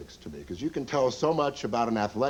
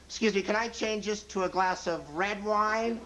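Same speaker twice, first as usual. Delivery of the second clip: demanding lady diner; 7 semitones higher than usual. Retold or imitated. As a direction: nag the waiter in a put-on high voice. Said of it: "their tone of voice when they order".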